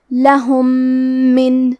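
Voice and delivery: لہم من with one doubled nasal م; idgham demo. Shown as a demonstration. Teacher modelling ghunnah on لہم من. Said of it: "غنہ اور تشدید کے ساتھ پڑھنا ہے".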